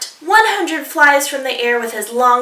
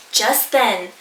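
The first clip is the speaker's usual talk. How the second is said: usual speaking voice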